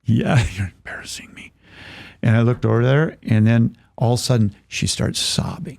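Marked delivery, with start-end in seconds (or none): 0.0-1.5 embarrassed mock whisper; hushed, voiceless complaint to a seatmate; whispered aside. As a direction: whisper it under your breath. Retold hushed plea to crying seatmate.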